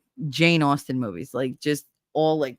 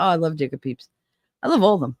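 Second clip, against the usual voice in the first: ordinary talk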